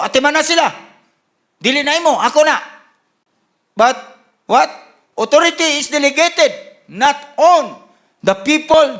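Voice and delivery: loud, high, emphatic sermon delivery; forceful preaching. Real, not acted. Preacher declares whose sheep they are, preaching at full force. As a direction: drive each phrase home to the congregation.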